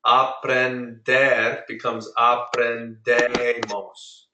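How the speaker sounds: very slow, each syllable of "aprendemos" drawn out; slow model. Shown as a demonstration. Teacher slowly showing the class how the ending changes. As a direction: spell the sounds out slowly for learners. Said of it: "Do you hear it?".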